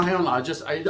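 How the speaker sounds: ordinary delivery, nothing marked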